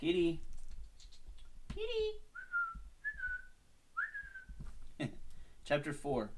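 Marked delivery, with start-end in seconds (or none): 0.0-0.4 higher, coaxing call; calling the cat. Real, not acted. 1.7-2.2 very high squeaky kitty; squeaky cat call. Real, not acted. Calling the cat. 4.0-5.6 very high breathy giggle. Real, not acted. Laughing at the cat.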